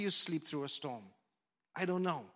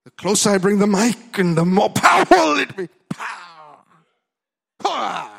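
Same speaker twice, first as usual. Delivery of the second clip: much louder, hammed-up mic-blasting yell; mock shouting preacher; 3 semitones higher, 17 dB louder than usual. Shown as a demonstration. Preacher mocking loud anointing-chasing preaching, hammed-up shout. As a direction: parody the shouting Pentecostal preacher. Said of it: "the louder you shout".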